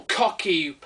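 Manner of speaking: ordinary delivery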